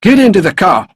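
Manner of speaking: loud, high, furious order; angry shout. A narrator's reading. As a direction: bellow the command at a captive. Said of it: "he shouted as he hit Mark".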